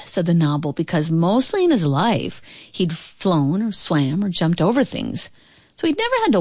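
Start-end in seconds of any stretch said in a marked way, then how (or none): none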